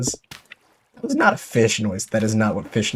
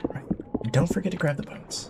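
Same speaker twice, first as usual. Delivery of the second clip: whispering; 5 semitones higher, 7 dB quieter than usual. Real, not acted. Quiet moment sneaking in game.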